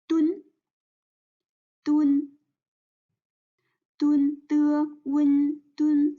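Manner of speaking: "dun" held high and level; first tone. Shown as a demonstration. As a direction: spell out "de, wen", then "dun", all on one high flat pitch. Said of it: "the tone is the first one".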